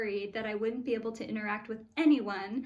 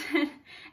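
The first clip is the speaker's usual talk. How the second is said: short, bright laugh at her own list of goals; light laugh; 4 semitones higher than usual. Real, not acted. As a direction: let a small amused laugh slip out.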